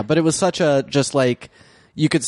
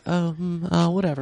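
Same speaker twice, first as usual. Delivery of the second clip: mock meek dismissal; 4 semitones higher, 4 dB quieter than usual. Imitates Jane shrugging off taunts.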